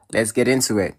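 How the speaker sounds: ordinary delivery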